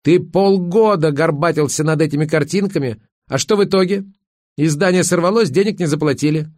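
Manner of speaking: high, indignant scolding; angry yell. A narrator's reading. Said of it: "закричала Верка". (возмущённо крича)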